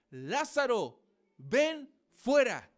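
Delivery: loud, high, slow commanding cry; Jesus calling Lazarus out, loud and slow. Retold or imitated. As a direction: call Lázaro out of the tomb. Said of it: "exclamó en alta voz".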